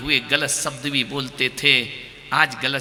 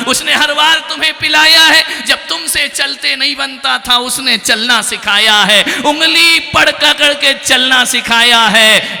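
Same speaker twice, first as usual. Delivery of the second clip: high, raised, insistent declamation; impassioned preaching; 11 semitones higher, 9 dB louder than usual. Real, not acted. Preacher reminds sons what mother taught, impassioned and insistent. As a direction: drive each point home to the crowd. Exhorts crowd at full voice.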